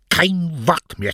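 high, slow stern command; strenges Machtwort. A narrator's reading. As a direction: lay down the law, drawn out. Mother cuts off her daughter. (strenges, gedehntes Machtwort)

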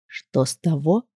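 soft, low, poisonous murmur; venomous rustle. Acted. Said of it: "ядовитым голосом прошелестел Маркус".

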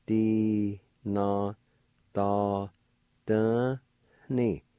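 "Di, na, da, da, ni" one at a time; nasalized syllables. Shown as a demonstration. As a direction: sound out "Di, na, da, da, ni" with the N nasal. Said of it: "it'll sound like this".

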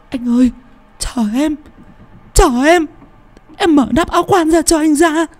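keen over the coffin, begging him to come out; wailing widow. Acted. Loan wails to her dead husband in the coffin, very high and grief-stricken. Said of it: "Còn Loan gào lên".

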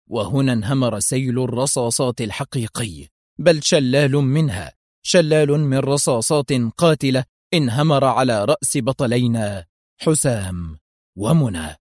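ordinary delivery

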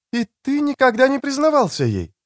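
high-pitched voice for a woman; woman's voice. A narrator's reading. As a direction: pitch it up for the female character. Narrator voices the woman character.

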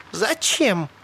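high, untroubled question; calm, even cheerful. A narrator's reading. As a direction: ask it lightly, as if nothing happened. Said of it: "Салли казалась ужасно спокойной, даже весёлой".

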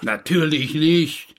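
affronted landlord snapping back; indignant. Acted. Landlord offended by suggestion.

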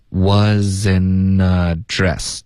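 wasn't address 慢慢连读; linked reading. Shown as a demonstration. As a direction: run wasn't into address slowly. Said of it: "Wasn't 跟address要连读". (wasn't和address连读)

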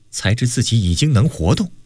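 ordinary delivery

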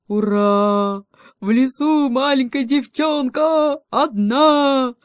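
high, loud, drawn-out whoop; gleeful wolf cub. Acted. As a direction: crow loudly over easy prey. Волчонок радуется добыче. (ликующий крик волчонка, громко и протяжно)

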